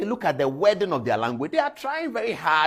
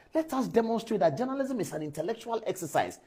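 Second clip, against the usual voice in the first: ordinary talk, nothing marked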